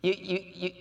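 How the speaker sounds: normal talking voice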